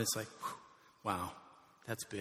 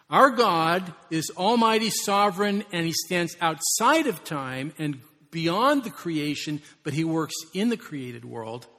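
louder, slow and weighty preaching; slow fervent proclamation; 3 semitones higher, 8 dB louder, slower than usual. Real, not acted. Pastor proclaiming God's greatness, slow fervent preaching. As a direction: proclaim each word like a creed.

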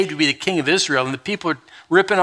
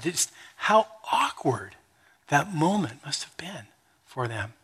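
slow hushed whisper; whispered aside; 2 semitones lower, 9 dB quieter than usual. Real, not acted. Pastor inviting listeners to feel the awkward moment, whispered. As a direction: lean in and whisper it.

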